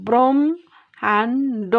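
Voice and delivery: ব্রহ্মাণ্ড drawn out very slowly as "brom-hand", the mh kept clear; slow careful demo. Shown as a demonstration. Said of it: "তাহলে আমরা এইভাবে লিখতে পারি".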